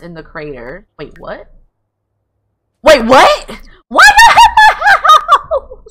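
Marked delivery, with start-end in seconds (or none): 1.0-1.5 soft, puzzled double take; quiet confusion. Real, not acted. Player reading invitation to the crater, quietly puzzled. 2.8-3.5 loud, high exclamation; shocked double take. Real, not acted. Player seeing who showed up, loud shock. 3.9-5.8 loud, very high, drawn-out laughing shriek; shrieking disbelief. Real, not acted. Player finding them all outside, shrieking laughter. Shock at crowd outside door.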